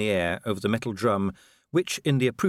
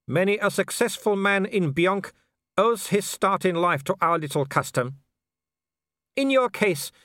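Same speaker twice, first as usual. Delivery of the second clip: put-on higher-pitched voice with a Germanic accent; haughty werewolf leader; 9 semitones higher, 3 dB louder than usual. Werewolf explains the game to his quarry, haughty. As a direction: play a foreign villain laying down terms.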